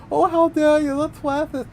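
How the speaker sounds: high, loud, prissy mock outrage; shrill mocking "how dare you"; mock offended voice.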